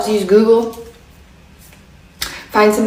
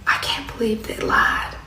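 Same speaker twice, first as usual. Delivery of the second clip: hushed, near-whispered admission; real quiet; 10 dB quieter than usual. Retold or imitated. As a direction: imitate someone confiding under their breath. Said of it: "she got real quiet".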